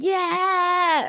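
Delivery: very high, drawn-out mimed whoop; retold cheer. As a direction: cheer from a boat, high but not full volume. Rescuers cheering from the boat.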